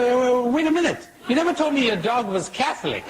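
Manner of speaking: high-pitched, hurried mock urgency; sudden eager backtracking. Priest calls back the donor of a million dollars, suddenly eager. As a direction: blurt it out as a comic punchline, voice shooting up.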